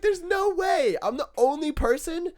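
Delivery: very high, exasperated shout; re-enacted worked-up outburst; triggered. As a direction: protest in squeaky comic disbelief. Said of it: "but I'm triggered".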